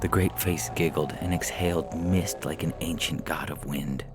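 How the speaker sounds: ordinary delivery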